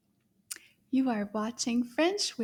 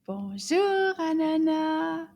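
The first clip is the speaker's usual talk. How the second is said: big loud bonjour to the puppet; loud greeting; 5 semitones higher, 6 dB louder, slower than usual. Shown as a demonstration. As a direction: call out the greeting nice and loud. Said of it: "in a nice loud voice".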